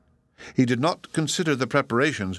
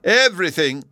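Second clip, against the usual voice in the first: Everything punched higher and louder; stronger remark; 8 semitones higher, 6 dB louder than usual. A narrator's reading. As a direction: lean hard on Everything. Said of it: "he strengthened the remark".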